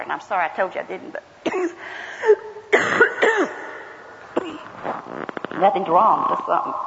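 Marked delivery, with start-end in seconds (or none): 1.4-3.8 harsh coughing fit, voice catching; dry cough. Real, not acted. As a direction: cough it out, struggling for air. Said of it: "I need some water".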